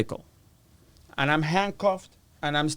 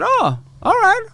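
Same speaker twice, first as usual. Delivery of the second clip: very high, sheepish retold reply; awkward acceptance; 4 dB louder than usual. Inmate answering a drug lord he wronged, sheepish. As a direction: squeak out an uneasy okay.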